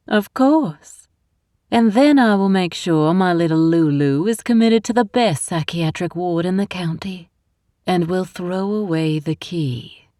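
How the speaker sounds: put-on sweet as syrup, rotten underneath, Southern accent; scheming Southern mother. A narrator's reading. Constance gloats.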